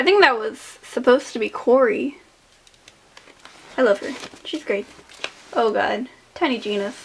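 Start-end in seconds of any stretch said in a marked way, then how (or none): none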